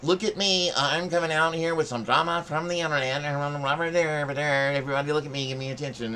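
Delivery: mocking her attention-seeking whine; mocking attention-seeker. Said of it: "you come out here with".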